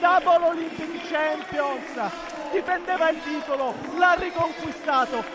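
high, overjoyed victory commentary; breathless Italian goal commentary. Real, not acted. Celebrating gold after the win.

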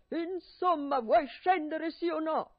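loud, high, slow shouting; impatient yell. Acted. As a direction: demand it at full voice. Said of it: "urlava Barbablù".

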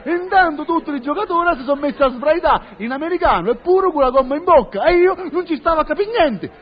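ordinary delivery

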